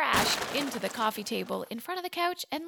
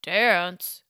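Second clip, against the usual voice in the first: groaned; 2 semitones lower, 7 dB louder than usual. Acted. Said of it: "groaned Frankenstein".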